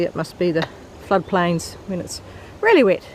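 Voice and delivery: ordinary talk, nothing marked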